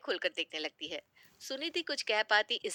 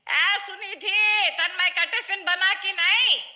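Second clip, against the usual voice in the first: mother-in-law shouting much higher and louder across the house; calling out; 11 semitones higher, 8 dB louder, slower than usual. Acted. Mother-in-law calls daughter-in-law from another room, loud high shout. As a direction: yell out slowly for the daughter-in-law, drawing out her name. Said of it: "Sharda जी फिर आवाज लगाती है".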